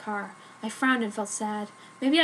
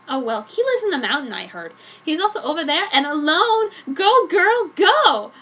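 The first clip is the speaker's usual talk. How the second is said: put-on higher, louder go girl cheer; 4 semitones higher, 6 dB louder than usual. Jenny pointing out Edward and urging Joan on, excited cheer. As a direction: egg your friend on with rising hype. Friend urges her on.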